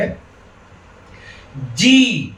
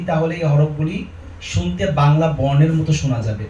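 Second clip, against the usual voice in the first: ordinary delivery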